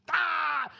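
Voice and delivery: hammed-up scream of pain; pained yell. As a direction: yelp as his foot is crushed. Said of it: "First he screamed".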